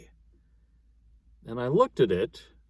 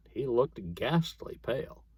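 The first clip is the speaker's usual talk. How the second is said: plain reading voice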